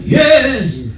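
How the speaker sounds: high-pitched mock scream; imitated crowd scream. Hundred fifty people answering the speaker, high imitated scream. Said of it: "Hundred fifty people screamed out".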